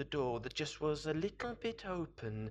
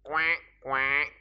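two loud, separate quacks; duck quacks; 9 dB louder than usual. Acted. Storyteller voices the duck.

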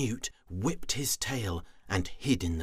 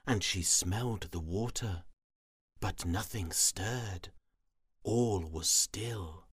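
plain reading voice

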